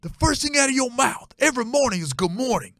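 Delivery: high, loud hammed-up lecturing stepdad; stern stepdad. Stepdad lays down the good morning rule, high mock lecture. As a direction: bark the rule like a petty tyrant. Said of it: "he looks at me, he goes".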